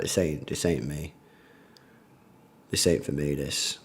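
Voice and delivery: trailing off, done with the show; resigned mutter. Retold or imitated. Telling chat about quitting the show with his girlfriend, soft resigned mutter. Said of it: "I was just like".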